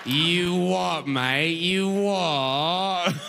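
slow, drawn-out mock British; mock British accent. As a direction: savor each sound in exaggerated British. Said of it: "They're just making sounds you like".

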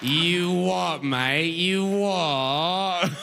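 drawn-out mock British catchphrases; hammed-up British accent, savouring each sound. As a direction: parrot British slang in a silly accent. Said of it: "and you'll be like".

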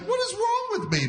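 very high, squeaky self-reproach; mock exasperation. Man questioning his old guy habits, mock exasperated wail. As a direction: wail it at yourself in mock dismay.

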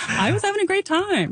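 mock-excited, sky-high squeaky voice; excited high squeak. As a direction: voice shooting way up high. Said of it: "like, six octaves up".

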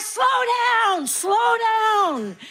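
high, drawn-out parental plea; high-pitched mock shout; calling out. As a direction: call after runaway kids to slow down. Parent calling to running kids.

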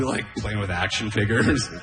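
ordinary delivery

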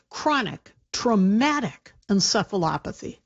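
deliberate, spaced-out enunciation of a long term; slow, careful pronunciation. Shown as a demonstration. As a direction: say the medical term slowly, word by word. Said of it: "I'm gonna say it slowly".

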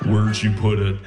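low, menacing sleep-talker; Buffalo Bill. Retold or imitated. Sleeping wife presses husband for an answer, low and creepy. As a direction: repeat the demand in a deep, eerie voice. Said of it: "Why do you sound like Buffalo Bill?".

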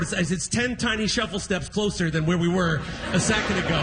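usual speaking voice

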